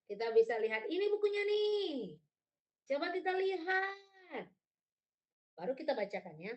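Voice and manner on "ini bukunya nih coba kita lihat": high, drawn-out storytime call; engaging children. Shown as a demonstration. Reader showing children the book, high inviting call. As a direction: invite the little ones in, pitched high. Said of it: "ajukan pertanyaan atau komentar untuk menghidupkan interaksi".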